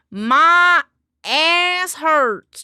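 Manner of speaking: high, loud whiny complaint. Real, not acted. Streamer to chat about her sore butt, loud whine. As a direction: belt out the complaint.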